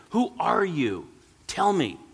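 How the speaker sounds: put-on a frightened old man pleading; pleading cry. A narrator's reading. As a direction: beg the voice to answer. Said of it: "cried the old shoemaker".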